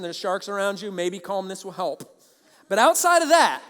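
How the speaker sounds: usual speaking voice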